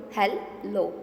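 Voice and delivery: "hello" in two separate beats; teacher slowly breaking "hello" in two; split into syllables. Shown as a demonstration. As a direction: say "hello" as hel, pause, lo. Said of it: "Two sounds".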